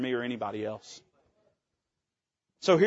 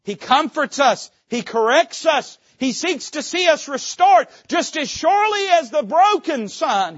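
preaching crescendo; 11 semitones higher, 7 dB louder than usual. Real, not acted. Preacher rising toward the climax about God seeking sinners, loud. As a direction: build to a full preaching shout.

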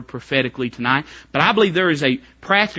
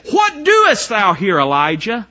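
loud, slow, high shout of God's question; preacher shouting as God to Elijah; God's call; 5 semitones higher, 6 dB louder, slower than usual. Acted. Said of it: "You know what God asked him".

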